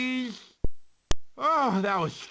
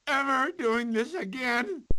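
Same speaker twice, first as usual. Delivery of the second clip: ordinary talk, nothing marked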